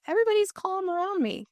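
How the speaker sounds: high, wide-eyed wonder; sudden happy surprise, voice jumping high; amazed delight. Retold or imitated. Mama amazed at calm household.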